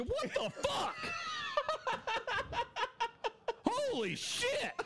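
screaming with shocked laughter; shocked, cackling shout; shocked laughing shout. Real, not acted. Shocked by a video clip.

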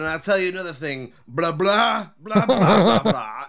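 blah-blah speech, building loud into laughter; mock oratory. Comic imitating a man holding forth, loud mock oratory.

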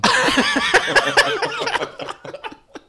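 long, loud, high cackling laugh; big laugh. Real, not acted. Co-host jokes about underwear, long loud laugh. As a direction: burst out laughing and keep going.

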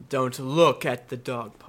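low, slow eerie announcer voice; ominous radio narrator. Pretend. Cohosts quoting Night Vale, slow ominous narrator voice. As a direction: intone it like a creepy radio host.

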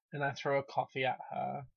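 usual speaking voice

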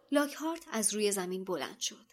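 plain reading voice

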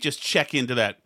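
ordinary delivery

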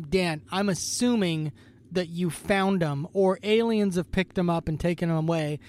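normal talking voice

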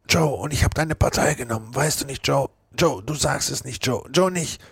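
put-on kneeling drunk pleading with his friend; begging Potter. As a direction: beg him not to tell. Potter begs for silence.

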